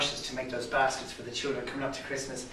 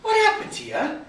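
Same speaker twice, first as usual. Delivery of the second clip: amazed cry; 11 dB louder than usual. Acted. Lads amazed.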